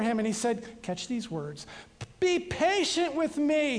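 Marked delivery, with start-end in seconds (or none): 2.2-3.8 a mild, slowed plea, pitched a little higher; a touch of desperate pleading; begging. Acted. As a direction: ask for patience as a gentle, understated beg. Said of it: "he begged".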